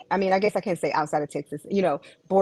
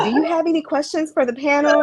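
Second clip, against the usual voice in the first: interviewer voice; 9 semitones higher, 8 dB louder than usual. Retold or imitated. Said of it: "and you'd be like".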